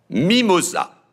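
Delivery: loud high call of the name; loud stern fatherly call. A narrator's reading. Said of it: "s'écria Narcisse".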